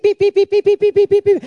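very high, loud chirping beeps; imitated sparrow chirps. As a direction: chirp like an angry little sparrow. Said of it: "and it went beep".

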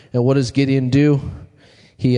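ordinary talk, nothing marked

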